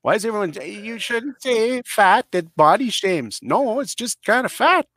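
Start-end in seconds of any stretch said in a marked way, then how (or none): 0.7-3.4 mocking quote. Mocking what everyone says.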